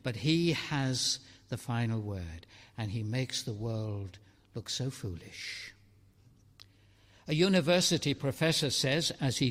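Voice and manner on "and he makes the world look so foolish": usual speaking voice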